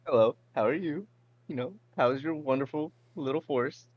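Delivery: high, loud sing-song greeting to a squirrel; bright, chirpy hello; sweet-talking a forest squirrel; cheery greeting. Acted.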